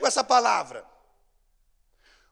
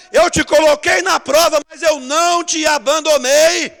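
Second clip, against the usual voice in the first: God proclaiming; 4 semitones higher, 11 dB louder than usual. Pretend. God's words proclaimed loudly.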